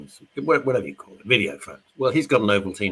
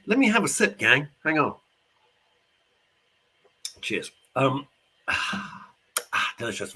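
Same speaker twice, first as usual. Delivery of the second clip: ordinary delivery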